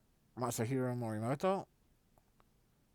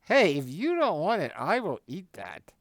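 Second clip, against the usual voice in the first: loud, higher, playful offer; joking; 8 semitones higher, 12 dB louder than usual. Retold or imitated. To the rich guest at dinner, a loud joking offer. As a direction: tease them that you'll eat it.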